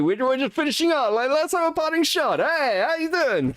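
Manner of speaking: high-pitched mock Italian accent; put-on Italian accent. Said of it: "I can do the Italian accent".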